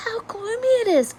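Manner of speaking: ordinary delivery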